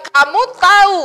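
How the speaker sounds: a loud, high scolding wife; emphatic wife. Retold or imitated. Sarah reproaching Abraham, loud and high. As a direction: hammer it home like a fed-up wife. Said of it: "Jadi dia bilang".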